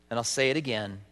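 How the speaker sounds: usual speaking voice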